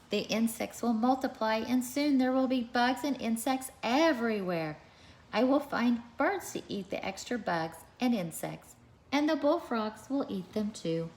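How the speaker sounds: animated picture-book read-aloud; reading to children. A narrator's reading. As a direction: read it out to a class of little kids.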